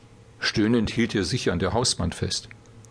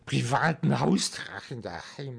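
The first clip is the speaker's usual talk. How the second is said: put-on despairing drunken slur; 4 semitones higher than usual. A narrator's reading. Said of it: "lallte er verzweifelt vor sich hin".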